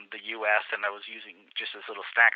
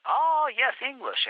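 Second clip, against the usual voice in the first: mock policeman, much higher pitch; eager policeman; 13 semitones higher, slower than usual. Policeman to the tourist, proudly higher-voiced. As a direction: play the policeman keen to show off his English.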